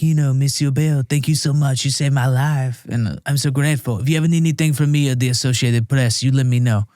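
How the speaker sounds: mock French accent, gushing thanks; lightly put-on French accent; grateful French reporters. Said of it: "Jacques and Pierre are like".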